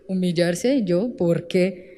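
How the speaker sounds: low, soft disdainful "me?"; a hint of haughty disbelief; mock scoff. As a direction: play the proud one who won't bow. Mocking a proud believer's reply.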